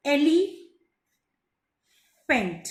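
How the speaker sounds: एलिफेंट very slow, long pause before "fent"; stretched एलिफेंट, eli, then fent; divided into parts. Shown as a demonstration. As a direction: sound out एलिफेंट in broken pieces. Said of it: "three dots में मैंने इसको divide किया".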